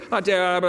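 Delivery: low, run-together mock gibberish; unintelligible garble. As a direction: imitate the incomprehensible kid in a garble. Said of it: "I think he said".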